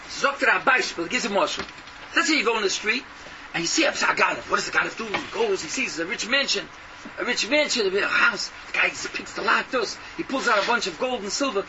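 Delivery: ordinary talk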